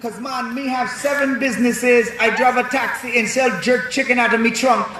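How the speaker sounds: high-pitched boastful Jamaican dad; hammed-up Jamaican father; Jamaican accent. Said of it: "Mister Winston was Jamaican".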